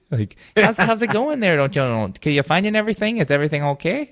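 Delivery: high singsong Minnesota clerk; Minnesota accent. Retold or imitated. Best Buy lady helping a newcomer, high Minnesota accent. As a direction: lay on the Minnesota lilt. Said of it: "they actually do talk like that".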